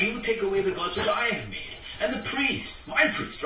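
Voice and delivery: high, wailing complaint of a robbed man; aggrieved Micah. Retold or imitated. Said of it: "But then he said that".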